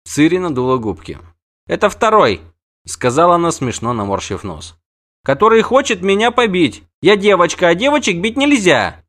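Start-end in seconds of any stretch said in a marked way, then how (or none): 1.7-2.4 put-on high, loud child's protest; indignant girl. Ciri indignant. 5.2-9.0 put-on high, loud child's protest; indignant girl. Ciri indignant.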